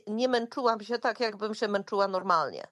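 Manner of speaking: ordinary talk, nothing marked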